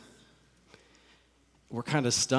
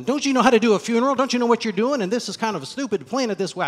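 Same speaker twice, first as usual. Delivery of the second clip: higher, louder, faster scornful scolding; mock a rude professional berating mourners; 10 semitones higher, 9 dB louder, faster than usual. Pastor imagines berating grieving families, loud mock scorn.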